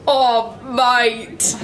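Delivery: badly faked Australian accent; amateur Aussie impression; attempted Australian accent. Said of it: "I cannot accent at all".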